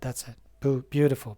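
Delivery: ordinary talk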